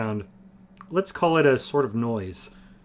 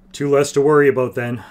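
louder, gruff approval; approving grunt; 4 semitones higher, 12 dB louder than usual. Acted. Barrick approving Hedar's killing of Murgos, loud gruff grunt. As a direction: grunt it out, pleased. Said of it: "Barrick grunted with approval".

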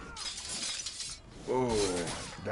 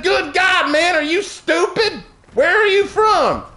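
very high, exasperated Southern argument; exaggerated Southern accent; 13 semitones higher, 4 dB louder than usual. Server arguing the law with a customer, exaggerated Southern. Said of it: "I'll stop with my southern accent".

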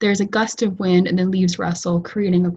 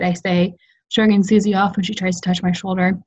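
ordinary delivery, nothing marked

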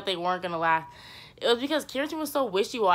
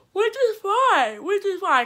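sarcastic; 12 semitones higher, 5 dB louder, slower than usual. Acted. Sarcastic shrug about the rumours.

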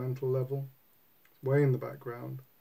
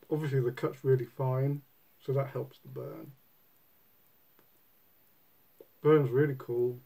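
talking around pipe stem; pipe in mouth. Real, not acted. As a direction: lips clenched on pipe. Seen 4: Pipe stem held between lips throughout, smoke visible around the head.

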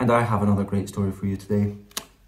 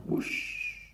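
hushed, airy gust; breath-only whoosh; soft wind whoosh; 14 dB quieter than usual. A narrator's reading. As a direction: blow it softly like distant wind. Narrator imitates the wind.

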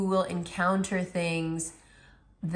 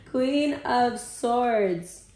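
dramatic announcement; 6 semitones higher, 4 dB louder, much slower than usual. Acted. Announces first tarot card.

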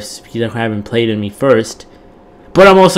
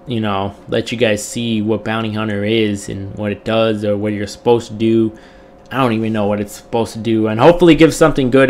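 ordinary talk

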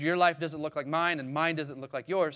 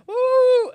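loud high mourning "ooh"; mock wail; 13 dB louder than usual. Preacher imitating mourners wailing at Lazarus' tomb, loud. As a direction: wail like a grieving crowd at the tomb. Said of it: "Imagine people wailing".

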